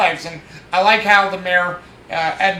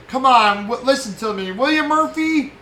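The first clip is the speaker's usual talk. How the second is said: mock pleading; 6 semitones higher than usual. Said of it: "gives one more pleading".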